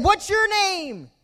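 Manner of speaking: high, slow, drawn-out shout; high-pitched acted call; boy calling out. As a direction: holler the question into the woods. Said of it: "he yelled out".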